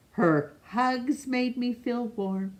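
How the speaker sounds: ordinary delivery, nothing marked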